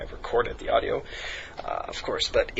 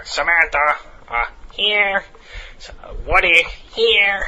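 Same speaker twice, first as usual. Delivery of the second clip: higher, louder mock teacher calling names; mock roll call; 6 semitones higher, 6 dB louder, slower than usual. Substitute teacher taking attendance, higher and louder. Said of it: "He's like".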